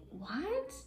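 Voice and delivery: high-pitched incredulous question; mock-shocked "what?". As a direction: react in retold disbelief. Retold shocked reply to negative friend.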